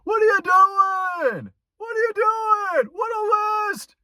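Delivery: hammed-up comic wailing disbelief at a list; strained high shout of a standup whining "what are we doing"; Seinfeld impression. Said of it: "that terrible, uh, Seinfeld little action impression".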